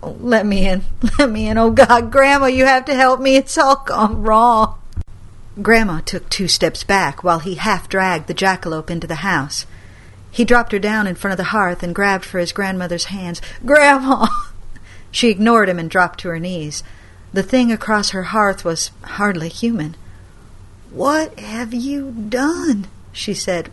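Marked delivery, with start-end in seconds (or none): 0.0-4.8 louder, higher tearful plea; sobbing grandson begging to come in; boy who had been crying begs his grandma; desperate begging. Acted. 13.6-14.4 loud, high cry of grandma; desperate plea. Acted. 20.9-22.9 slow, high horrified question; grandma seeing the creature, appalled; aghast question. Acted. Grandma shocked.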